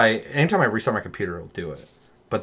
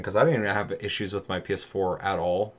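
ordinary delivery

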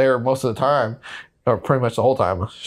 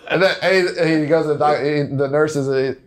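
usual speaking voice

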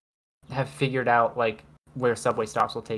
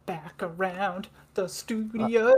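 slow, higher host welcome; mock host voice; 5 semitones higher, slower than usual. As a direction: roll it out like a radio host. Host voice after break.

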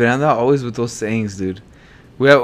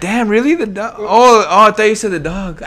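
mock reaction; 8 semitones higher, 3 dB louder than usual. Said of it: "I would've been like".

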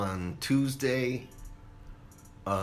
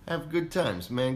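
radio jingle voice; 6 semitones higher than usual. Retold or imitated. Radio jingle tagline imitation.

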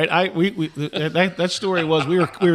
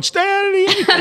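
very high mock yell; retold yelling. Assistant coach copying the lead coach's call, mock yelling. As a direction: parrot the coach's call, pitched way up. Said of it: "I just started yelling".

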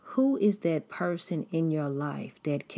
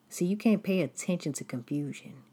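ordinary talk

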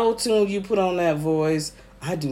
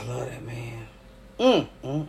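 weepy; 5 semitones lower, 7 dB quieter than usual. Real, not acted. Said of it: "I am so sorry I was weepy".

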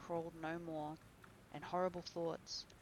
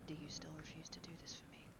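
tense whisper of a dreadful secret; very quiet; 11 dB quieter, faster than usual. A narrator's reading. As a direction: whisper it so no one else hears. Dorian's whispered plea.

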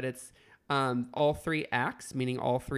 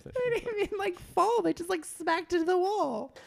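excited retelling. Real, not acted. Laughing retelling of comic scene.

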